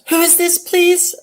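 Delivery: high, put-on clueless voice; mock confused wife asking who calls; imitating the wife. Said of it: "she was totally clueless".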